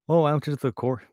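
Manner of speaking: full Minnesota accent. Shown as a demonstration. Showing the accent of the cornfields, thick Minnesota accent. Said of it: "get the full accent".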